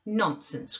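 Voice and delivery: put-on a girl losing her temper; passionate cry. A narrator's reading. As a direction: snap it out in a passion. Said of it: "cried Catherine in a passion".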